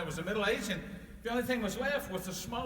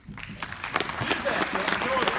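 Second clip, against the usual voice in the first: breathy exhortation; 6 semitones higher, 7 dB louder, much slower than usual. Acted. Santa's closing words.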